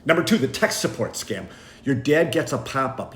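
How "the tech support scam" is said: ordinary talk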